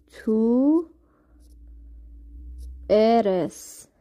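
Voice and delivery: تو ارس said as Spanish "tú eres", rolled r. Shown as a demonstration. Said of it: "اول تلفظش رو براتون بنویسم". (تو ارس را با تلفظ اسپانیایی بگو)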